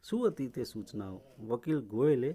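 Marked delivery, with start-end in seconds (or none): none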